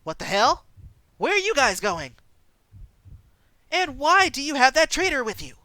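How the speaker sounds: loud, rising cultist demand; suspicious challenge. Acted. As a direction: stop them and accuse.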